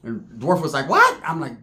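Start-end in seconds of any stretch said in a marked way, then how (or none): 0.9-1.2 much higher, loud squeak; shocked what. Retold or imitated. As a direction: squeak it in disbelief. Dwarf's shocked reaction.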